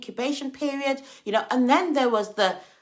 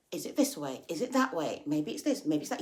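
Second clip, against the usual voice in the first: low, quiet unsure muttering; mock dithering; 6 semitones lower, 6 dB quieter than usual. Imitating someone walking without a clear vision, dithering. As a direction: dither softly between options, pitched low.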